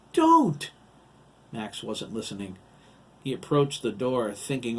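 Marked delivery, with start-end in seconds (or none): 0.1-0.7 high, very loud desperate shout; crying out. A narrator's reading. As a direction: shout to stop him. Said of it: "Matt cried".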